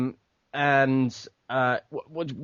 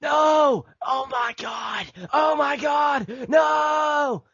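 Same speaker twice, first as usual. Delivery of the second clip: much higher, strained mock yell, held back; mock whisper yell; 13 semitones higher, much slower than usual. Shown as a demonstration. Mocking bad abridged voice acting with a whisper yell. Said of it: "the whisper yell".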